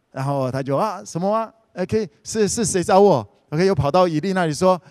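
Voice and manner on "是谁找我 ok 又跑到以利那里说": usual speaking voice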